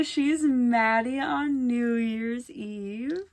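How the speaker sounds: usual speaking voice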